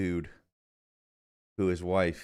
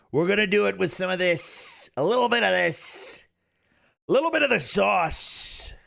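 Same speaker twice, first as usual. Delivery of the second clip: high-pitched sales-pitch impression; Donald Trump; 8 semitones higher than usual. Streamer promising saucier article readings, Trump voice. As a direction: parody the president, stretching the line endings. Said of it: "having Trump talk about".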